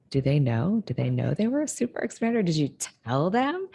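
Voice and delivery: excitedly quizzing someone, voice swooping up; eager questioning. Acted. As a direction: press them eagerly, pitch climbing to the end. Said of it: "I wanna be like".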